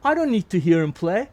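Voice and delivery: high, loud, amused insistence; emphatic retort. Retold or imitated. Milcho tells the band he needn't hear him, loud emphatic punchline. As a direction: land the punchline with gusto.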